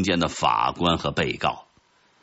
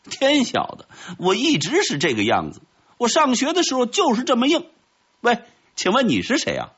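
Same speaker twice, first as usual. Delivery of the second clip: high-pitched, cocky little character voice; reply boldly in a high, lively voice; confident. A narrator's reading. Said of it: "自信地说". (尖着嗓子自信地回答)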